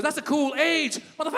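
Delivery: high, boisterous approval; brash rapper friend. Retold or imitated. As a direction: hype it up like a rapper buddy.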